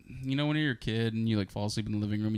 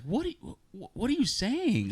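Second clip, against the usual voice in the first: high, drawn-out incredulous questioning; retold bewilderment; 11 semitones higher than usual. Arguing with a sleep-talking girlfriend, retold bewilderment. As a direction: act out baffled exasperation, pitched way up. Acting out argument with sleep talker.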